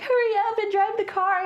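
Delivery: high, urgent hurry-up shout; mock panicked yell. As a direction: yell at her to drive. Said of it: "he's like".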